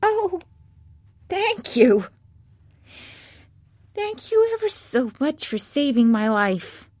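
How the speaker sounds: high-pitched squeaky field mouse; squeaky little voice. A narrator's reading. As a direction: squeak out grateful thanks as a tiny mouse. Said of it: "in a squeaky little voice".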